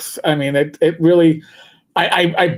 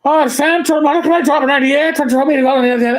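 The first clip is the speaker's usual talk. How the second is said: a higher, fast, thick Irish brogue imitating a cop on the radio; 8 semitones higher, faster than usual. Retelling a brogue-heavy cop calling Central, thick Irish brogue. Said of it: "a cop with a very thick Irish brogue".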